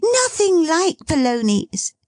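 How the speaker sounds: put-on lively girl teasing her dad; girlish Meg. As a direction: tease your father brightly.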